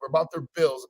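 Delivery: usual speaking voice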